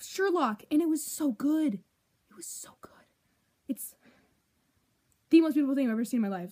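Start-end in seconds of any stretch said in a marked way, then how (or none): 1.7-3.9 slow, breathless whispered adoration; reverent whisper. Real, not acted. Fan whispering to camera how good the show was. 5.3-6.5 rushed gush. Real, not acted. Gushing fast.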